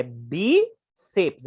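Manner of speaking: "bíceps" split slowly into BÍ, ceps; two separated syllables. Shown as a demonstration. Teacher showing it has two syllables, slowly separated syllables. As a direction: stretch the stressed BÍ of "bíceps", then "ceps". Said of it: "é uma dissílaba".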